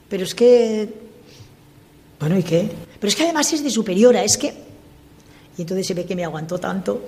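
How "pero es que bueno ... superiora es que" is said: fast, raised, higher indignant protest; a touch of mock outrage, quick and loud; retold anger. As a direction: blurt it out in a rush, still fuming. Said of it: "estaba rabiosa".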